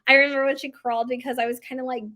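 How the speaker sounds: normal talking voice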